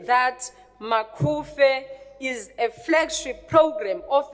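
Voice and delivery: slow, weighty formal declaration; slow, deliberate emphasis. Real, not acted. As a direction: spell it out slowly so nobody misunderstands. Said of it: "I'm going to say it slowly".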